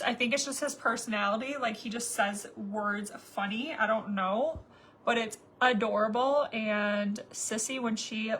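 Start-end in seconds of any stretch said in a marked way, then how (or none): none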